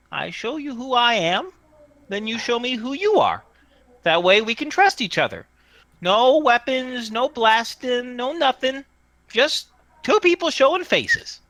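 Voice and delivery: high, bargaining little voice; small tinny voice. Acted. Tree voice proposes a trade of faces, tinny. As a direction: lay out a deal in a small high-pitched voice. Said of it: "says the voice from the tree".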